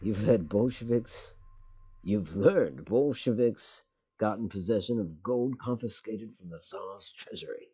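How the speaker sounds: put-on a light, half-hearted British accent reading the briefing. Streamer reads the mission briefing to chat in a mild British accent. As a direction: read it like a stiff British narrator, only just. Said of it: "I don't know why I'm trying a British accent here".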